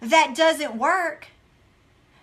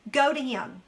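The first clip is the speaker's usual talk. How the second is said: ordinary talk, nothing marked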